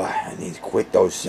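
ordinary talk, nothing marked

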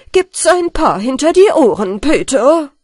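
stern scolding. A narrator's reading. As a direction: threaten firmly, raising the voice. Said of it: "sprach der Lehrer ernst".

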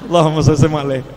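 rushed blessing through a laugh. Acted. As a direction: laugh while gabbling the blessing. Debtor joke, laughing.

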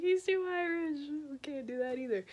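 squeaky dismissive officials; comic high-pitched snub; snooty rejection. Retold or imitated. Admiralty rejecting Crozier.

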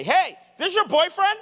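high, loud mock-teasing call across the room; really loud teasing. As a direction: embarrass the kids with a loud, high taunt. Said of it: "Like, really loud".

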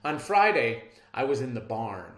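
plain reading voice

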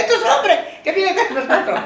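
high, laughing quote. Retold or imitated. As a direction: giggle through the words.

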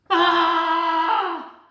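high loud scream; frightened scream. A narrator's reading. Boy and monster scream on seeing each other. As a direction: scream in terror at the sight.

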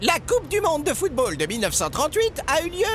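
high, grave magician voice; magician character. A narrator's reading. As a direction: explain the plan solemnly. Magician speaking.

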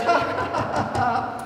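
high, acted "ha ha ha"; mock crowd laughter. The crowd reacting to Rod's joke, put-on laughter. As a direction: imitate an audience laughing, high and staccato. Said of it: "And the crowd goes".

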